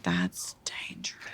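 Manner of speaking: whispered warning. Retold or imitated. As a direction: breathe it out as a worried whisper. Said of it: "and I'm like".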